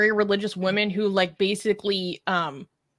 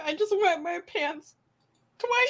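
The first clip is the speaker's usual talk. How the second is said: much higher, squeaky, laughing replay of the line; giggly squeaky repeat; 9 semitones higher than usual. Real, not acted. Host repeats the best chat comment to cohost, squeaky and giggling. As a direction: squeal it out fondly, still amused.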